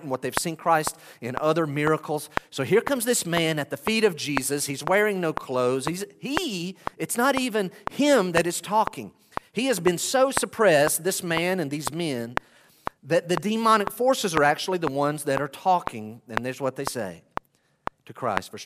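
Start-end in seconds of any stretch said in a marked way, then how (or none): none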